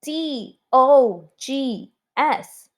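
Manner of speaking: slow, letter-by-letter spelling aloud. Shown as a demonstration. Teacher spelling always for the class, slow letter by letter. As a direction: space out each letter for the class. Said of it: "let's spell it".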